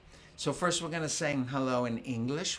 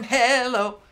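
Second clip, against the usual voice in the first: loud call; 8 semitones higher, 10 dB louder than usual. Shown as a demonstration. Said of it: "I'll sing 'em".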